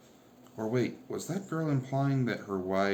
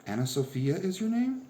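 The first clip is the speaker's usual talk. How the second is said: surprised question; 11 semitones higher, 4 dB louder than usual. A narrator's reading. Doreen surprised, checking.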